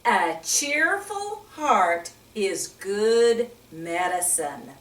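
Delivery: slow, deliberate memory-verse reading; like a teacher leading a class recitation. Shown as a demonstration. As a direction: recite it slowly so kids can follow. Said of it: "Let's say it one more time".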